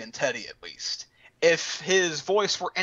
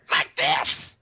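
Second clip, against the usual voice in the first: the unwanted voice. Retold or imitated. Showing the cat voice they do not want, less cute. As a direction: sound like the annoying version they dread. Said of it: "but not like".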